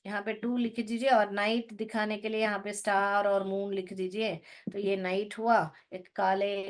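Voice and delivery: ordinary delivery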